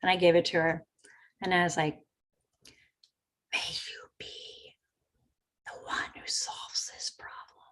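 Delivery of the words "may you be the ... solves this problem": slow, soft, unvoiced whisper; whispered blessing to the agent. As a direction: breathe the wish like a spell. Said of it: "I kind of whispered".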